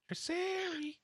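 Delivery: Triceri squeaked high and soft; high squeaky voice. Shown as a demonstration. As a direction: say Triceri like a tiny creature. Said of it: "It's a Pokemon".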